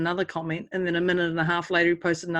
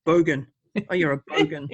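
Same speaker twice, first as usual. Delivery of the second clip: broad Kiwi bogan voice, lower; Kiwi bogan accent; 4 semitones lower than usual. Shown as a demonstration. New Zealander showing how Kiwis say bogan, in a broad Kiwi accent. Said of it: "We go".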